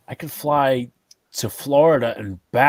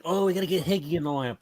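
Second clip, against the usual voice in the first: higher, quick mimicry of griping fans; mock complaining fans; 5 semitones higher, faster than usual. Fan mocking people bitching about the lineup, complaining imitation. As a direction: imitate the complainers in a hurried, raised pitch. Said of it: "why people were bitching".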